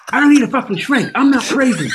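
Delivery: high, loud and fast indignant protest; exasperated outburst. Retold or imitated. Retelling how he insisted to Jason he's not crazy, a loud high outburst. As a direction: blurt it out like you're insulted. Escalating retort about needing shrink.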